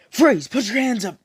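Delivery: sudden loud arrest order; police shout. Acted. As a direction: bark it like a cop at a crime scene. Police bursting in.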